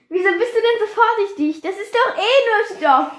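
high-pitched, scoffing re-enactment; dismissive impatience. Retold or imitated. Friend scoffing at the other's caution by the fence, dismissive. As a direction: chide a friend for being so careful, pitched right up. Retold impatient question before shock.

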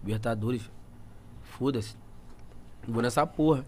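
talking himself into going, under his breath; hushed inner grumble; quiet resignation. Retold or imitated.

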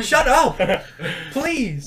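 high laughing mock protest; laughing mock snap. Student mimics telling teacher to stop, laughing. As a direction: pretend to snap at her while laughing. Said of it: "I'm like".

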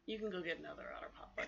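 hushed unvoiced whisper. Real, not acted. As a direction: whisper it quickly. Whispering during recording.

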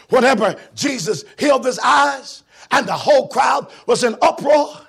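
loud, driving preacher's shout; preaching at full force. Acted. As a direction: preach it out to the crowd.

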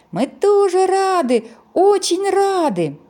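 high, loud, slow joyful chorus; excited clamor. Acted. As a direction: shout it out gladly together. Snowmen clamor together.

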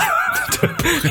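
real high laugh burst; shrill laugh. Real, not acted. Laughing at the Ave Maria line, high real laughter.

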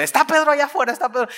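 put-on high, excited servant girl calling; a girl's high excited call; Rode at the door. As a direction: blurt it out high and eager. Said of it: "escuchando la voz de Rode".

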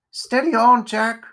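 loud, high calming call; urgent spider character. Acted. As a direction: rein in a raving companion. Spider checks the raving pumpkinhead.

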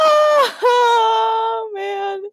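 high, loud laughing cry of oh; real amused shout. Real, not acted. Cracking up at the story.

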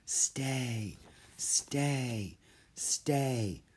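soft, echoing creature voice; spider whisper. A narrator's reading. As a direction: breathe it out like a giant spider. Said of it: "a loud whisper echoed".

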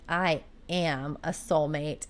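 low, slow, soft admission; quiet, deliberate retold confession; mock solemn declaration. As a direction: imitate a husband giving in, word by word. Husband gives in, retold.

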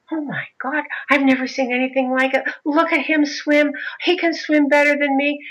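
high-pitched imitation of an awed crowd; amazed onlookers. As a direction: marvel like astonished poolside onlookers. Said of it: "they're all like".

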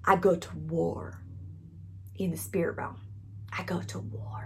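hushed, intense whisper. Acted. Preacher describing spiritual warfare, in an intense whisper. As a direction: whisper it like a secret weapon.

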